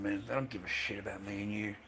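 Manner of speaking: normal talking voice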